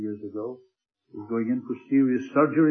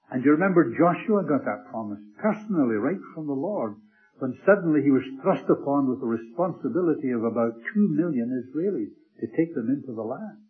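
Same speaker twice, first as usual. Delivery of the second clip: ordinary talk, nothing marked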